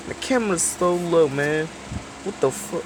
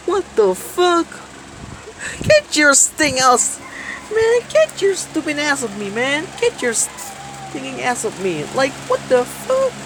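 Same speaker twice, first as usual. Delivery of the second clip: annoyed yell; 8 semitones higher than usual. Real, not acted. Yelling at someone to get off.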